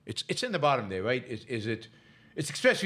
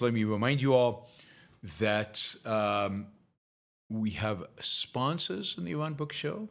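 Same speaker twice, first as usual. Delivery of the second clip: ordinary talk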